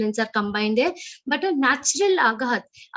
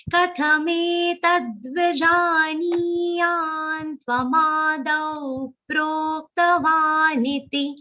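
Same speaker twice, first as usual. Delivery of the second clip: drawn-out long vowels; 3 semitones higher, slower than usual. Shown as a demonstration. Teacher asking students for longer vowels, slow chant. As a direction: chant slowly, stretching every long vowel. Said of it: "say all of them a little, uh, longer".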